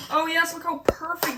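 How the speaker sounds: loud, high burst of joy at the rice; thrilled delight. Real, not acted. Cook thrilled at perfect rice, loud delight. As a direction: gush over the perfect rice.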